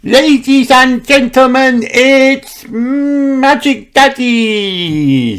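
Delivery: high, loud, very slow showman announcement; grand introduction. A narrator's reading. Peppa introducing Magic Daddy to the audience. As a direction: present him like a circus ringmaster. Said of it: "said Peppa".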